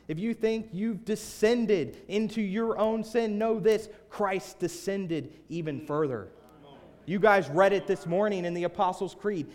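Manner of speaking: slow, high, loud proclamation; full-force preaching. Real, not acted. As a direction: drive each phrase home to the congregation.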